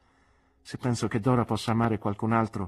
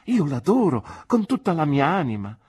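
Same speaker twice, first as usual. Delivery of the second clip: fervent declaration; 9 semitones higher, 9 dB louder than usual. Acted. Young man declares love.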